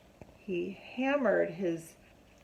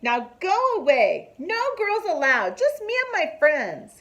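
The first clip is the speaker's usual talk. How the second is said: loud, high bossy shout; yelling; 8 semitones higher, 6 dB louder than usual. A narrator's reading. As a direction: chase the little sister off. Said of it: "he yells at her again".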